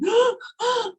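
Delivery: sharp high gasp, imitating the sobbing client; retold gasp. Retelling the client's reaction, a high mock gasp. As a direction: gasp in sharply like someone overcome.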